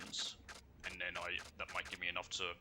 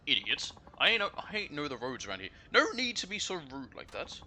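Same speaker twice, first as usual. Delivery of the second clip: rude Kansai lady; 5 semitones higher, 4 dB louder than usual. Acted. Rude passenger scolding driver.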